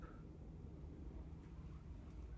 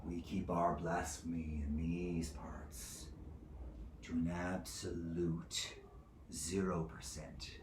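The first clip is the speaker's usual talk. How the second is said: slow, low-volume warning; hushed warning; 3 semitones lower, 9 dB quieter, slower than usual. Acted. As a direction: drop almost to a whisper and measure it out. Judge warns about blasphemy.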